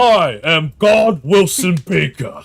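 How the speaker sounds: loud, slow godlike proclamation; a shouted declaration of godhood; booming boast. Pretend. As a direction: announce yourself as a mighty god. Imagined ripped Willy boasting.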